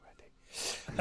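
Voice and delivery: whispered aside. Real, not acted. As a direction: breathe it as a sly aside. Quiet aside while hosting.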